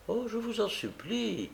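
high, soft imploring cry; hushed, high-pitched entreaty; forceful pleading. A narrator's reading. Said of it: "dit avec force la jeune fille".

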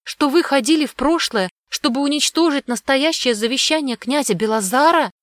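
put-on high, agitated child's voice; impetuous blurting. A narrator's reading. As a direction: press the accusation as an excited girl. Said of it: "выпалила порывисто Огнева".

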